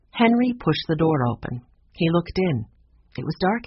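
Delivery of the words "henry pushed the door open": ordinary delivery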